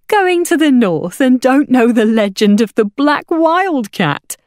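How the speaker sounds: high, loud, slow disbelief; astonished exclamation. A narrator's reading. Hawker woman to travellers new to the legend, astonished exclamation. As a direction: exclaim as if scandalised by their ignorance. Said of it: "exclaimed the porridge woman".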